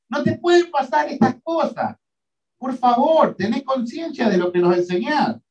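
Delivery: high, pleading rebuke of a friend; acted Peter rebuke. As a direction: scold him like a worried disciple.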